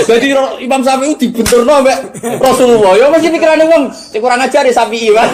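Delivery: meniru penuduh, keras dan bernada tinggi; tuding dengan suara lantang meninggi; komentar provokatif. Acted. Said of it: "Terus oleh membuat komentar gini". (komentar nuduh, banter lan dhuwur)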